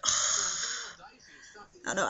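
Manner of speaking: slow, breathy, voiceless sigh; whispered sigh. Real, not acted. Sighing, worn out.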